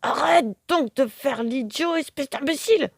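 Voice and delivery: high, exasperated telling-off; scolding grumble. A narrator's reading. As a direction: scold the silly parrot. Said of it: "en grommelant".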